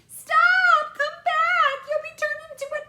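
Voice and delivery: very high, very loud frantic screaming; frantic mother. A narrator's reading. Mother screaming for her son to come back. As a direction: plead in a panicked scream, slowing at the end.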